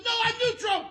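high, loud retold denial; mock shout. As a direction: yell the denial back. Roy's retold denial at its peak.

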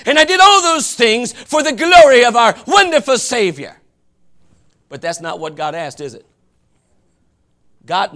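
usual speaking voice

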